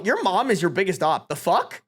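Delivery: usual speaking voice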